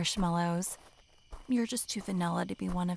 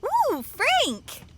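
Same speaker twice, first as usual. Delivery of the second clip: excited squeal; 7 dB louder than usual. Acted. Excitedly naming the hot dog.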